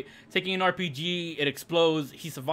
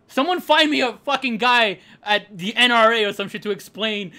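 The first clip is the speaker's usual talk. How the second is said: normal talking voice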